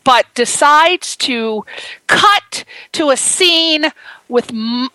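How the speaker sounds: usual speaking voice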